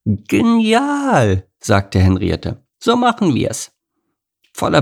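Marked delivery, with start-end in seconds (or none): 0.0-1.5 high delighted animal voice; squeaky approval; high character voice. Acted. Henriette delighted. 2.8-3.7 high eager animal voice; bright high agreement; high character voice. Acted. Henriette agrees.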